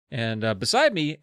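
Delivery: usual speaking voice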